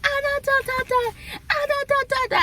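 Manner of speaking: high-pitched mock yell in nonsense syllables; mimicked yelling. Retelling a rant in the hallway, high mock yelling. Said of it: "'Cause I was yelling, y'all".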